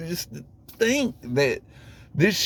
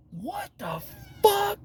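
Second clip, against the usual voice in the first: incredulous; 7 dB quieter, slower than usual. Retold or imitated. Retold disbelief, very high.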